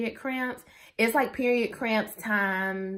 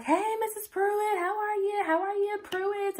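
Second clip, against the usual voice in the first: much higher, chirpy greeting voice; cheery doctor; 12 semitones higher than usual. Retold or imitated. Doctor greeting the couple, high and bubbly. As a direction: play the doctor bubbly and sing-song. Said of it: "my doctor's like".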